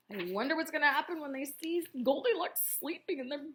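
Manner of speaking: usual speaking voice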